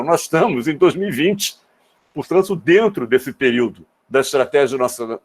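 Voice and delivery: ordinary talk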